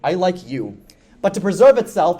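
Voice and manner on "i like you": lower, quicker, lightly voiced mask; a hint of a haughty magic mask picking its favorite; smug sentient mask. Sentient mask crowning Motoro its favorite, lightly voiced quick and low. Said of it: "the Mask of Life is like".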